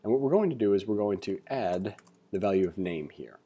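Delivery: ordinary talk, nothing marked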